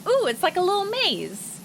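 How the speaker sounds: put-on a child thrilled to find a maze; delighted kid. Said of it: "I was like".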